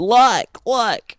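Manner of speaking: "like" said high and stretched; mock the filler "like" loudly; exaggerated "like". Shown as a demonstration. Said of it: "it's gonna be like this".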